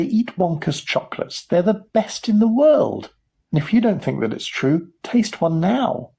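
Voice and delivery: high, emphatic sales pitch; television advert voice. Acted. Wonka imagining his chocolate advert, television advert voice. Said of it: "then a voice will say".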